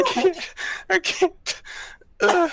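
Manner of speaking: drawn-out, high words squeezed through laughter; can't get the words out for laughing; helpless laughter. Real, not acted. Friend overcome by a joke in game chat, helpless laughter.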